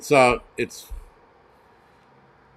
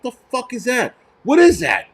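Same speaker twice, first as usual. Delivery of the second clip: exasperated reaction, pitched way up; baffled outrage; 9 semitones higher than usual. Retold or imitated. Acted baffled reaction to story.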